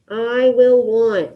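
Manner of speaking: very slow, deliberate prompt; slow dictation. Shown as a demonstration. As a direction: spell it out slowly for the class. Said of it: "let's go with I will want".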